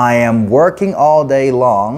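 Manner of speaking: "I am working all day long" drawn out very slowly; careful slow English demo; slow model sentence. Shown as a demonstration. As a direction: model the tense change slowly. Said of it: "ก็รู้ tense นิดหน่อยใส่เข้าไป".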